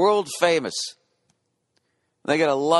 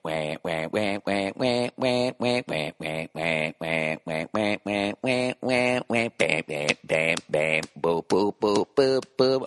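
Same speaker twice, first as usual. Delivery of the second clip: imitated electronic beat; 4 semitones lower than usual. Mimicking a DJ's electronic drop.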